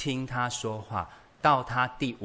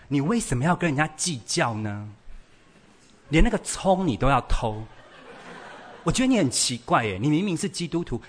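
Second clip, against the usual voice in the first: building indignant complaint, higher and louder; acted scolding; 4 semitones higher, 4 dB louder than usual. Child listing mother's faults to her face, scolding. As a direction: play a child telling off a parent.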